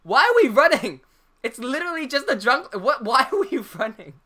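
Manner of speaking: mock baffled outburst; retold exasperation. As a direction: act out suddenly questioning the whole chase. Retold exasperated question mid-chase.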